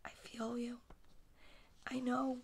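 very soft, near-whispered murmur; hushed sympathy. Real, not acted. Reader pitying the boy with messy toys, hushed sympathy. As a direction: commiserate under your breath.

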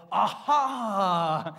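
loud delighted exclamation; eureka cry. Acted. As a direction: cry out as the student finally gets it. Acting a student's realization.

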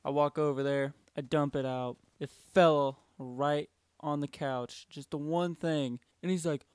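ordinary delivery

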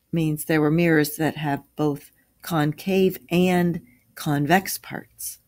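ordinary delivery, nothing marked

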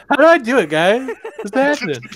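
mocking quote. Teasing a friend about his confusion, mocking quote. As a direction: lightly mimic a confused player. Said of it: "He's over there going".

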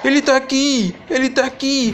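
high panicked shout. Real, not acted. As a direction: yell in sudden fright. Monster appears, panicked shout.

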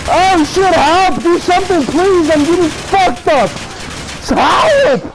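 high, frantic pleas for help; panicked yelling. Real, not acted. Getting shot, begging teammates.

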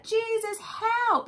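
high, urgent cry for help; desperate plea. Retold or imitated. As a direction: beg like a frightened father. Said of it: "He's like".

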